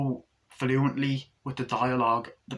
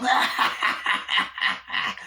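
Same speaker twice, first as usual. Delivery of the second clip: imitated maniacal laugh; villain's cackle; 9 semitones higher than usual. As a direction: cackle like a killer doll. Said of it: "Chucky starts laughing".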